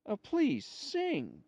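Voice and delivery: high, climbing plea, acted out; pleading. A narrator's reading. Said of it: "The man pleads".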